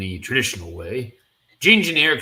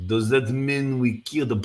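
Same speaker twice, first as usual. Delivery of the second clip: usual speaking voice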